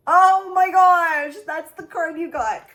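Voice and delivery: thrilled gasp of disbelief; real excitement. Real, not acted. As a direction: burst out in delight. Thrilled by the card drawn.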